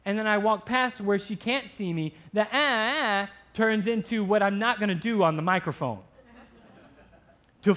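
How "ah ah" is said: mock baby whine, drawn out; put-on cry for attention; imitated little cry. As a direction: mimic a fussing baby briefly. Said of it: "It's not a real cry".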